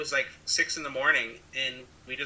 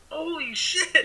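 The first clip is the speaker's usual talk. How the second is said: much higher, startled "Holy shit"; a half-laughing squeal of alarm; mock shock; 12 semitones higher, 4 dB louder, much slower than usual. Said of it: "they couldn't be like".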